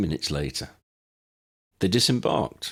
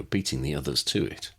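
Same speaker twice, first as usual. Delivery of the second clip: usual speaking voice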